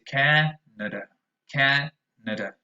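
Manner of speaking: Canada with a strong first a and weak schwas after; Canada, KAN-uh-duh; schwa endings. Shown as a demonstration. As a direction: stress CAN, then weak uh sounds in Canada. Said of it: "The other two are schwas".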